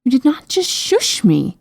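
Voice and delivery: haughty, indignant outburst; indignant cry. A narrator's reading. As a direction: cry out in offended disbelief. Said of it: "she cried".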